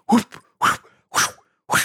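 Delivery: breath-only whooshing bursts; quick unpitched movement noises; imitated swoosh. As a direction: mimic air whipping past fast arms. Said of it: "the air is making that noise".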